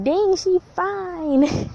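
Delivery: slow, high drawled appreciation; checking a girl out. Retold or imitated. Teen girl mock-admiring a cute girl, slow and high. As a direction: stretch it out, admiring. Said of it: "I'll be like".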